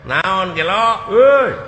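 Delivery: loud. Acted. Acted yelling after cry.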